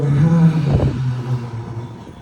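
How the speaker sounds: big audible sigh out; heavy releasing sigh; loose, wiggling exhale. Shown as a demonstration. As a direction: shake out a long low breath. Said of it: "Exhale with a wiggle".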